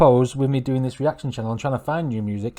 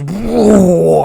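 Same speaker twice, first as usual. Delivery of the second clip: loud, high imitated guitar roar; big drawn-out guitar noise; guitar imitation; 6 semitones higher, 12 dB louder than usual. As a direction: mimic a guitar crashing in at full blast. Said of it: "then the guitar goes".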